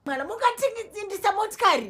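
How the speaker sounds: shouting in outrage; angry shout. Acted. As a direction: yell it at your mother. Furious quarrel with mother over car.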